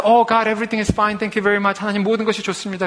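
high, loud, hammed-up cheerful prayer; mock fake cheer. Preacher mocking a believer's falsely cheerful prayer. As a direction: ham up a falsely happy believer. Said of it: "그건 믿음이 아니에요".

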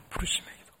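부르짖음의 기도 breathed out in a whisper; hushed repeat. Shown as a demonstration. Preacher whispering the title to the congregation.

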